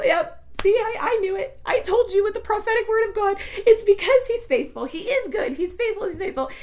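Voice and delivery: high, bright vindicated exclaiming; high triumphant praise; triumphant told-you-so. Retold or imitated. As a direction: crow that you knew it all along. Retelling her triumphant told-you-so.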